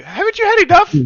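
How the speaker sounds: high, loud mock scream of a fed-up wife; 妻子尖叫. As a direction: screech it like a scolding wife. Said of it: "wife starts screaming at you".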